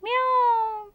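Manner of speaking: high mewing imitation of a cat; cat meow. Shown as a demonstration. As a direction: meow up high like a little kitten. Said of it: "మరి cat?".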